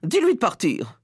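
high, indignant order; scandalised lady's command; outraged wife. Acted.

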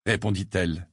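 ordinary delivery

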